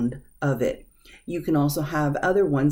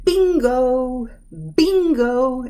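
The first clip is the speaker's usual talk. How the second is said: high, loud sing-song bingo, bingo; excited win; 7 semitones higher, 4 dB louder, much slower than usual. Shown as a demonstration. Teacher sing-songing a winner's bingo. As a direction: cheer like you just won. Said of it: "like you won something".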